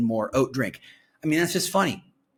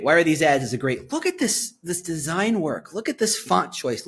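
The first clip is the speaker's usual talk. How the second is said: ordinary talk, nothing marked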